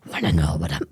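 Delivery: very low rasp, breaking off; Batman. Acted. Streamer taunting Batman until a cough, deep growl. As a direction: force out a deep throaty growl. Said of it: "That's Batman's voice, not Joker's".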